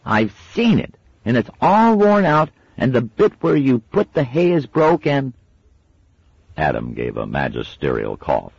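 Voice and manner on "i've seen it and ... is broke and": put-on very high, loud accusing kid; tattling child. A narrator's reading. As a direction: pile on the complaint. Little sister accusing.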